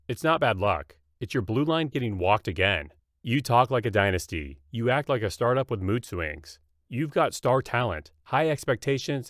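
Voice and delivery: ordinary talk, nothing marked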